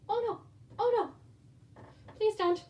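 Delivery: startled alarm. Real, not acted. Mother startled as the baby grabs, high alarmed cry. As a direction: cry out in sudden real alarm.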